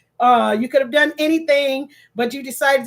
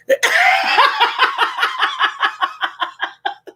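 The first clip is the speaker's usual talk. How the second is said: high, long cackle; helpless happy laugh; delighted laughter; 12 semitones higher than usual. Real, not acted. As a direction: burst out laughing, overwhelmed. Overcome by birthday gift.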